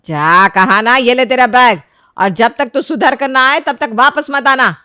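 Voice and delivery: loud, forceful order to leave; shouted scolding. Acted. Mother-in-law hands over the bag and banishes her, shouting. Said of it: "जोर से चिल्लाकर बोलती है".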